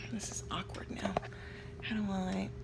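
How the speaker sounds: hushed awkward whisper. Real, not acted. Vlogger feeling awkward on camera, whispered. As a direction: whisper it, embarrassed.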